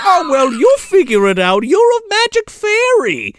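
high, offhand brush-off; breezy Santa. Retold or imitated. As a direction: wave the problem away. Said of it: "he's like".

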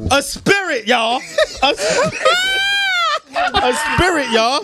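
high laughing delivery. Real, not acted. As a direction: crack up through the punchline. Punchline repeated, laughing.

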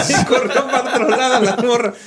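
cracking up as he tells it; laughing. Real, not acted. Laughing at retelling the bride. (se ríe mientras cuenta que la novia se fue)

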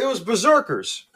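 high and loud, blurting out the answer; excited recall. Real, not acted. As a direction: blurt it the moment it comes back. Finally names the song.

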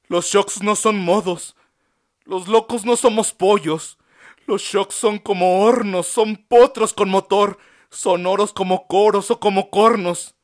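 high, loud, passionate defiant monologue; impassioned speech. A narrator's reading. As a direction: plead your dignity loudly to the doctor. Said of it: "monologó con honroso modo".

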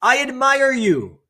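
high, loud, stretched-out call; acted scream. Son screaming to the mountain, long high call. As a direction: holler it slowly to a far mountain. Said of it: "he screams to the mountain".

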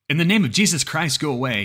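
high, loud plea to begone; shouted command. A narrator's reading. Man with a rifle ordering the creature away, shouting. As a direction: call it out forcefully into the dark.

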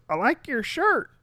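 put-on high, slow old-lady voice; drawn-out, high-pitched compliment from an old lady.

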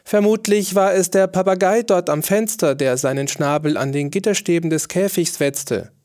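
high, eager courtier's voice; smooth things over for the king; fawning courtier. A narrator's reading.

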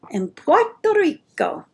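Puerto Rico with a light Spanish accent. Shown as a demonstration. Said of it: "I just like saying it like that".

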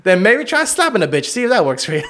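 hammed-up persuasive pimp; A Pimp Named Slickback. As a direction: push it like a cartoon pimp coach. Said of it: "he was trying to teach Tom".

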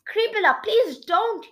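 high, loud plea; narrated alarm, begging to be spared; pleading protest. A narrator's reading. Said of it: "he protested".

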